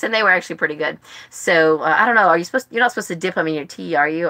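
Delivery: ordinary delivery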